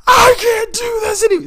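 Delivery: very high, very loud wailing scream; imitated crying scream. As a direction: shriek it like a boss breaking down. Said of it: "just screaming".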